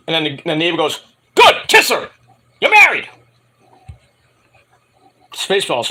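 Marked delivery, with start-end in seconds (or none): 1.4-3.1 high, loud mock command; mock urgent order. Neighbor urging Jensen to kiss her, loud urgent mock order. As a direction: call it out like an impatient officiant.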